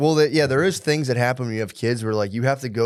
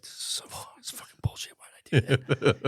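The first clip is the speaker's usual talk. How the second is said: hushed, whispered cursing to yourself; retold fretting under the breath; whispered self-reproach; 6 dB quieter than usual. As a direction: whisper it in dread. Dreading punishment all day, muttering.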